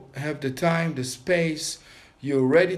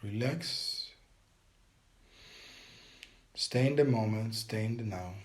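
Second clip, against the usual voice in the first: guided meditation. Acted. Teacher guiding viewers through a breathing meditation, calm and steady. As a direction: coach them through each breath and hold, pressing on hold it.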